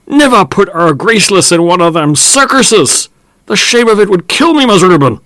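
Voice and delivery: high, loud cry of dismay. Acted. Old farmhand crying out in dismay to Mus Reuben. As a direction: wail in horror at the thought of the circus. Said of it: "a piercing cry of dismay".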